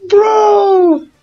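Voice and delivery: high, startled yelp of dismay; a sudden squeal as his Pokemon goes down; shocked cry. Real, not acted. Player yelping as his Pokemon faints.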